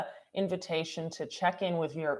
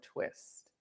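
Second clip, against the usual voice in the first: a breathy whisper, almost no voice; whispered cue; 11 dB quieter than usual. Real, not acted. Teacher cueing the twist in a whisper. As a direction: breathe the word out softly.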